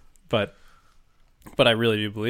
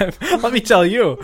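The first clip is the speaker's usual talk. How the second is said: much higher, slow, laughing country voice; put-on yokel with a giggle; mock folksy voice, laughing; 11 semitones higher, 8 dB louder, slower than usual. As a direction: ham up the rural twang. Joining the folksy bit.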